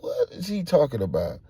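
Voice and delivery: high-pitched bewildered question; puzzled and pressing; baffled. Retold or imitated. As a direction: ask in disbelief, voice up. Reacting to confusing news.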